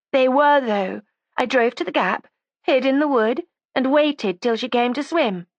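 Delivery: put-on high, defiant young woman; resentful young woman. A narrator's reading. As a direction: voice her as a sulky, stubborn girl. Judy's voice again.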